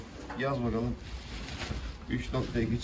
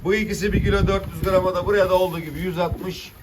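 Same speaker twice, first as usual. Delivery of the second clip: auctioneer; 5 semitones higher, 4 dB louder than usual. Acted. Fish auction calling prices.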